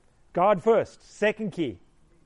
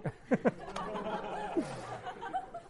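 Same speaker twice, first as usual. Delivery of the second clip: real laugh; 7 dB quieter than usual. Real, not acted. Laughing at own story.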